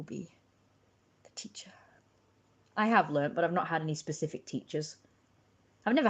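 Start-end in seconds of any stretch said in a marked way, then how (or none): 0.0-1.7 breathy whispered reveal of the teacher. Acted. Whispering to the stream, mock mysterious whisper. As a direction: whisper it like a secret title.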